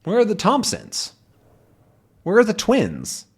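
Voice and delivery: ordinary delivery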